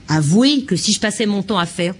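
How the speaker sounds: ordinary talk, nothing marked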